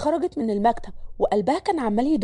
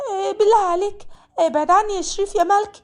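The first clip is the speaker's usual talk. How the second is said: high, louder desperate plea; begging maid; 8 semitones higher, 3 dB louder than usual. Acted. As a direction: beg him to back away. Malak begs him to leave her. (ملك بتترجى شريف يبعد عنها بيأس)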